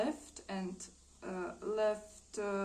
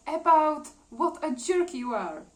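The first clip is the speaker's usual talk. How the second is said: loud, higher, indignant acted comeback; angry retort; 7 semitones higher, 10 dB louder than usual. She answers his malicious delight, indignant. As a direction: throw the insult back, louder. Woman snaps back at gloating man.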